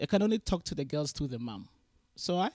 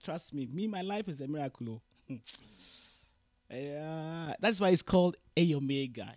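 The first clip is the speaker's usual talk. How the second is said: ordinary delivery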